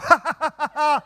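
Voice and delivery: high burst of laughter; lone laugh. Retold or imitated. Student laughing alone in a lecture hall. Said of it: "So I laugh".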